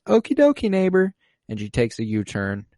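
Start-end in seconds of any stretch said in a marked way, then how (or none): 0.1-1.1 very high, loud sing-out; chirpy neighbor act. Retold or imitated. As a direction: imitate a mind-controlled neighbor, pitched way up. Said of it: "And she says".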